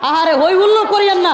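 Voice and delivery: exasperated call. Real, not acted. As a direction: rise over the crowd, fed up. Scolding the noisy crowd. (বিরক্ত হয়ে জোরে থামতে বলা)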